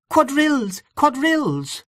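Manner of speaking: high-pitched, excited announcement; excited cry. A narrator's reading. Young woman bursting into the room to announce the dance, crying out excitedly. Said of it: "excitedly clapping her hands and crying".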